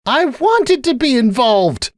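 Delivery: very high, slow plea; pleading. A narrator's reading. Sunburst begging Cupcake to understand, high pleading. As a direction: draw it out imploringly. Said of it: "Sunburst pleaded".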